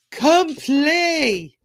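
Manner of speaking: high, loud sing-out call; playful, ringing beckoning; excited invitation. Real, not acted. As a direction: call friends over to join in. Calls viewers to join her group.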